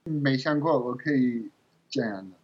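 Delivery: usual speaking voice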